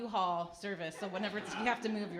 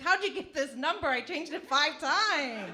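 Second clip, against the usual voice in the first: exasperated; 6 semitones higher, 5 dB louder than usual. Retold or imitated. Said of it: "I'm like".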